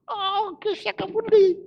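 fired-up revival preacher; full-force preaching. Acted. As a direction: preach it hard to the church. Preaching to church congregation.

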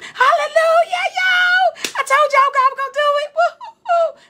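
high, loud, fast shout of joy breaking into laughter; re-live the scream of victory full out; screamed hallelujah. Retold or imitated. Preacher reliving her scream when her students passed, joyful shout. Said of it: "And I screamed".

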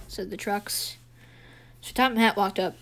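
ordinary delivery, nothing marked

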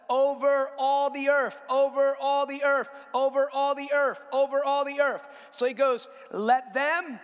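rising sermon climax, each repeat hammered; preaching at full force. Acted. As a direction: drive it home to the congregation.

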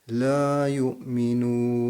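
yu'minun with the u held four counts, slow; slow chant, long final vowel; long nun. Shown as a demonstration. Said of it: "nu harfini dört saniye uzatta".

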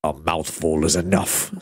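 very low, slow snarl; low menacing dragon snarl. A narrator's reading. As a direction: growl it like a hungry beast. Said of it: "snarled the dragon".

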